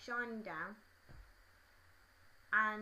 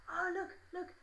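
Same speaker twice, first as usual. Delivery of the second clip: high put-on boy's excitement; eager, high mimicked voice; excited schoolboy; 10 semitones higher than usual. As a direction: imitate a boy urging his mate to look. Acts boy showing off flip book.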